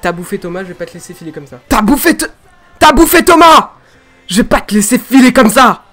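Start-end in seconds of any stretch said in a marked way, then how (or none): 1.7-5.8 loud, high yell of rage; furious dubbed shout. Shown as a demonstration. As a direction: scream vengeance at the monster. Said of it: "c'est bien". (hurlement de colère)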